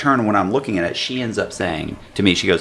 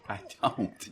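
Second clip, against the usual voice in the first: ordinary talk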